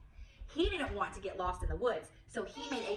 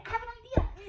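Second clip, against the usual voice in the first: excited idea; 13 semitones higher, 5 dB louder, faster than usual. Shown as a demonstration.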